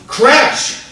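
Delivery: sudden loud high crash; dramatic burst. Retold or imitated. Barber reliving the brawl for the judge, dramatic.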